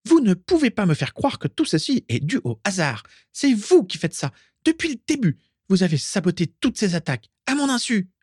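high, indignant tirade; furious accusation. A narrator's reading. Carla blaming them for sabotaging the attacks, furious.

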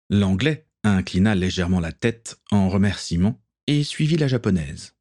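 plain reading voice

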